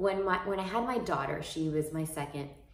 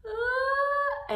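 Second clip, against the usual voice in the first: sharp gasp breaking into a very high mock sob; gasp, then burst into a high cry; imitated breakdown gasp; 7 dB louder than usual. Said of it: "I proceeded to cry".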